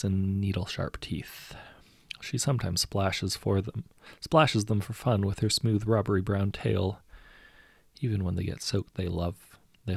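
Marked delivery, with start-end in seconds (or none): none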